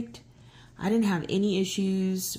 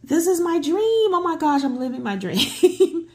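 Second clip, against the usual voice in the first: high, loud gushing, breaking into a laugh; giddy excitement; 10 semitones higher, 6 dB louder than usual. Retold or imitated. Traveler reliving landing in her dream destination, giddy and laughing. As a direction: gush as if the dream is coming true. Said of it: "I'm so giddy because".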